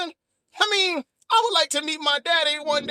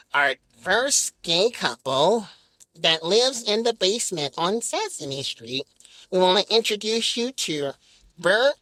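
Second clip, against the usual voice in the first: deep, slow host announcement; low and drawn out like a kids' show host; Big Bird voice; 8 semitones lower than usual. Pretend. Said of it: "He's like".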